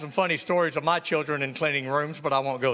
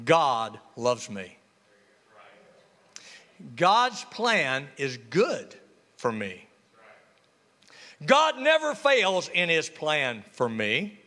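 slow, low, earnest affirmation; sincere; 5 semitones lower, slower than usual. Shown as a demonstration. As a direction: let each promise sink in gently. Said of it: "There are some things we shouldn't doubt".

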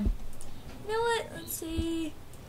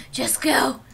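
whispered, breathless urging; pleading whisper at the ball; tense whisper; 5 semitones lower, 5 dB louder than usual. Real, not acted. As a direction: whisper it so as not to jinx it. Willing the catch to work.

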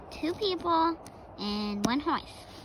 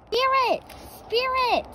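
calling out; 9 semitones higher, slower than usual. Acted. Dad calling from afar.

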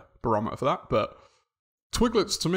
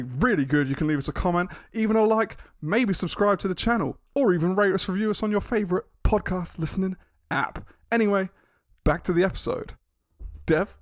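high, loud hype announcement; announcer voice; 5 semitones higher, 3 dB louder than usual. Acted. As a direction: pump up the listeners like a DJ. Hosts podcast announcement.

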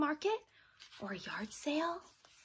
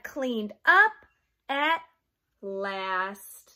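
lively picture-book read-aloud; reading to children; 4 semitones higher, 10 dB louder, slower than usual. Acted. Teacher reading a storybook to her young class, lively. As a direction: read it like storytime for little kids.

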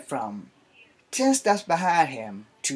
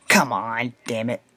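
normal talking voice

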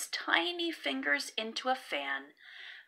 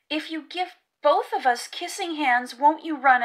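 put-on high, louder child's worried question; little raccoon voice; 6 semitones higher, 6 dB louder than usual. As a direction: wonder aloud like an anxious little kid.

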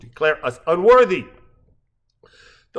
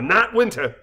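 ordinary delivery

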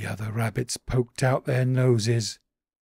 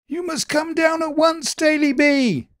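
high, loud panicky call; urgent rabbits crying out; 7 dB louder than usual. A narrator's reading. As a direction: shout the warning up the tree in a panic. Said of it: "they cried".

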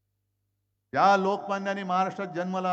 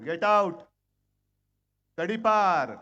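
loud, punchy rally cry; rally shout; 6 dB louder, slower than usual. Real, not acted. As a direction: hurl it at the crowd. Rally crowd, calling for ouster.

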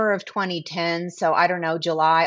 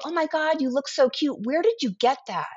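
put-on high, gushing girlfriend squeal; mock-gush over a friend's cute outfit; gushing compliment; 6 semitones higher than usual. Woman gushing to a friend about her cute jersey, high and excited.

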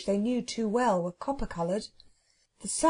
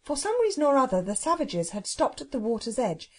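usual speaking voice

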